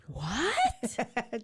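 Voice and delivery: high, strangled mock scream; whisper scream. Imitating Caroline shocked by April, whisper scream. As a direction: squeal a shocked, held-back scream. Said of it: "in her whisper scream".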